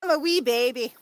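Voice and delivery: loud, badly faked Scottish accent; attempted Scottish accent. Streamer joking about age with friends, attempted Scottish accent. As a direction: have a clumsy go at sounding Scottish. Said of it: "Was that a Scottish accent you just tried?".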